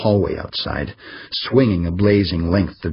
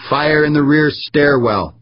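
louder, higher machine announcement; blaring alarm voice; 7 semitones higher, 4 dB louder than usual. A narrator's reading. Computer warning of fire, blaring alarm voice. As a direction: call it like a building alarm.

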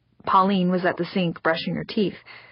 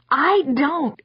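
put-on indignant girl; 8 semitones higher than usual. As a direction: deny it like a stung child. Daughter denies.

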